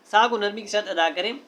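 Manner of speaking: ordinary talk, nothing marked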